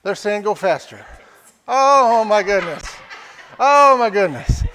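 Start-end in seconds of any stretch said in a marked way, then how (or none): 1.6-4.6 loud, overwhelmed exasperation; exasperated. Real, not acted. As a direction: call out, worn out by the crowd. Overwhelmed by bids at auction.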